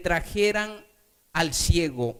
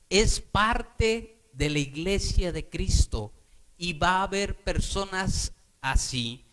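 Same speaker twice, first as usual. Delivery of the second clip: ordinary talk, nothing marked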